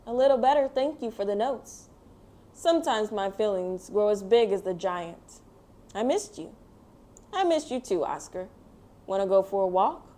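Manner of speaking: put-on gentle storybook narration read to kids; reading to children. A narrator's reading. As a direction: tell it like a bedtime picture book. Reads picture book aloud.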